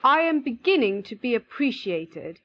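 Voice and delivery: lightly voiced happy young playwright; pleased exclamation. A narrator's reading. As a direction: exclaim with understated delight. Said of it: "he cried".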